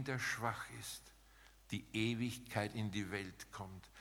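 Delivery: ordinary delivery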